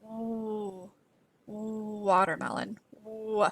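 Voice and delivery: "watermelon" said with no A sound, the lips rounded into "ooh"; watermelon without the A. Shown as a demonstration. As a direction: try saying "watermelon" with no A at all, so it comes out as "ooh". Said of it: "try saying the word watermelon without the A".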